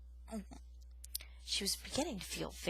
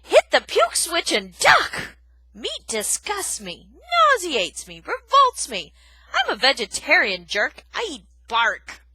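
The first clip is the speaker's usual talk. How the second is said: loud, slow revolted tirade; disgusted rant; 6 semitones higher, 14 dB louder than usual. Acted. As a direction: spit out your disgust. Aerith disgusted by meat.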